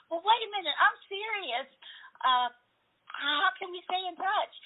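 high, urgent calling out; eager plea. Retold or imitated. Pulling up beside the driver again, high eager plea. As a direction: catch him before he drives off. Chasing car owner at light.

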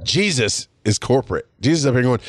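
ordinary talk, nothing marked

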